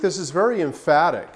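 ordinary talk

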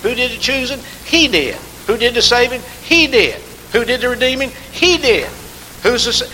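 high, loud rhetorical calls and answers; call-and-response preaching. Acted. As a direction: fire each question and answer back.

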